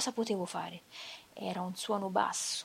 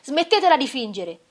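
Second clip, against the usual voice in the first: shouted confession; 2 semitones higher, 14 dB louder than usual. Acted. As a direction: break down screaming the confession. (confessione urlata ai funzionari, in delirio)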